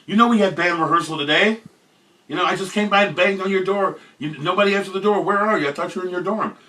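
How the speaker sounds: high, loud, exasperated bandmate; angry bandmate. Retold or imitated. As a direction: chew him out for missing rehearsal.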